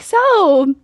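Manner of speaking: imitating the masseuse making small talk mid-stretch; mock calm masseuse. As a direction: ask it breezily, as if nothing odd is going on. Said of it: "the way that she was like".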